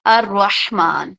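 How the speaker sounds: ar-Rahman drawn out slowly in Arabic style; slow Arabic name. Shown as a demonstration. Teacher slowly pronounces الرحمن for students. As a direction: pronounce الرحمن slowly as a-rah-man with care. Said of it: "اللہ کے بعد جو first نام آتا ہے".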